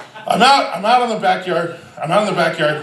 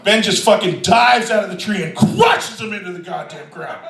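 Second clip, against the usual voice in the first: ordinary talk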